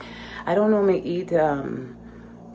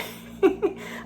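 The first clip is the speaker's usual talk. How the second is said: high embarrassed giggle. Real, not acted. Embarrassed laugh about TMI.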